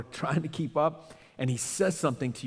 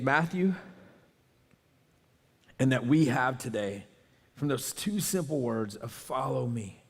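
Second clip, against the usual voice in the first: soft, prayerful pleading, fading softer; closing prayer; 4 semitones lower, 4 dB quieter than usual. Acted. Pastor prays over the congregation, soft and pleading. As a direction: pray it quietly over the congregation.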